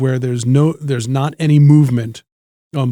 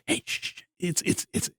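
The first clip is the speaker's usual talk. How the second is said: very soft, voiceless hushing; whispered shushing; 3 semitones higher, 13 dB quieter than usual. Retold or imitated. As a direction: imitate an audience whispering for quiet. Audience hushing a loud drunk man.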